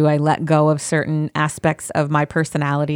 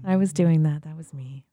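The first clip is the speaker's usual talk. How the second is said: soft, hushed offer to soothe; mock-meditation murmur; playful Zen voice; 7 dB quieter than usual. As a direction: lull them back into calm, very softly. Slipping back into Zen voice.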